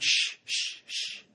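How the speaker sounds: whispered shh to hush someone; library patrons hushing; shushing. Retold or imitated. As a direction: hiss a quiet shush at a noisy reader. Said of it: "everybody around me went".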